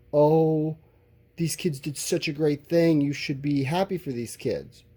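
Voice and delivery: ordinary delivery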